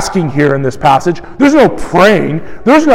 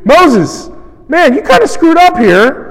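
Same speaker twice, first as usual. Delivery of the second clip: higher, pushier mock grumble to Moses; sharper complaint; 8 semitones higher than usual. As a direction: needle Moses, a step more accusing. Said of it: "increasing intensity and antagonism".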